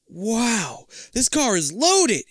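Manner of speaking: high, wide-eyed wow; amazed exclaim. Acted. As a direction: gush over the fancy car. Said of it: "you declare".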